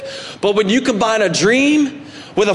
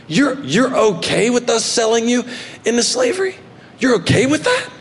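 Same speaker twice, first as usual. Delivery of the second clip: incredulous; 5 semitones higher than usual. Retold or imitated. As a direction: voice leaping higher in disbelief. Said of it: "his brothers looking at each other and going".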